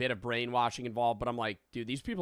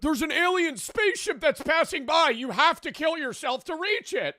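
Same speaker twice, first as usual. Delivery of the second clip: high, louder, hammed-up cult leader; mock cult preacher; 5 dB louder than usual. Mocking the cult leader's pitch to the podcast host, high and hammed-up. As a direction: ham up the alien sermon, pitched high.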